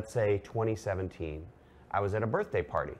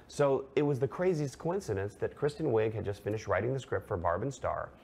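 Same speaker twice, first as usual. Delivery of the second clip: usual speaking voice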